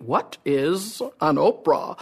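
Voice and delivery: slow, drawn-out mock promo question; street interviewer. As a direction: stretch it out like a campaign interviewer. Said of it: "they're interviewing people on the street".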